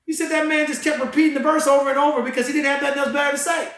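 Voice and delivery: rising into a full excited preach; fired up. Real, not acted. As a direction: tell it like you're lighting up the room. Fired-up retelling continues.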